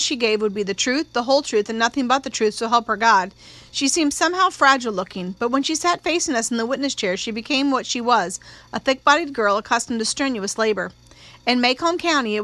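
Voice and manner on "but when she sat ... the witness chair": ordinary delivery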